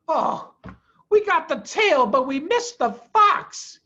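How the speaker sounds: farmer Bean. A narrator's reading.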